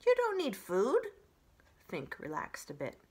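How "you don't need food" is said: surprised. Acted. Fox surprised.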